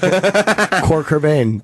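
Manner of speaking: slow, drawn-out mock Spanish accent; mimicking Spanish accent. Co-host relishing the guest's mistake, mock Spanish accent. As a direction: linger on her Spanish-accented pronunciation. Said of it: "It's my Spanish accent".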